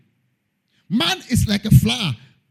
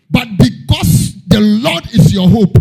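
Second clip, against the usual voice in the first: preaching at full force; 4 semitones higher, 9 dB louder than usual. Acted. Preaching climax.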